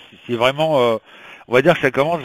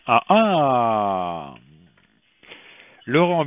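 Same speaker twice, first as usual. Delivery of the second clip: ordinary delivery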